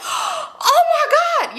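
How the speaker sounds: high, drawn-out gushing cry; thrilled surprise. Retold or imitated. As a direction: gush it in delighted shock. Retold thrilled reaction on recognising him.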